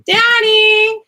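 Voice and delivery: loud call across the house. Real, not acted. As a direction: shout for Daddy from another room. Calling out for dad.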